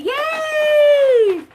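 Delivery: high, loud yay; excited yay. Acted. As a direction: cheer with all your might. Celebration shout.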